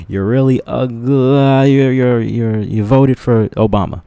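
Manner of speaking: high, loud mocking punchline; mock sarcastic jab. Mocking people's excuses to the stream, loud and high. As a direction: fling the insult, slowly at first.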